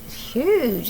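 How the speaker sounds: plain reading voice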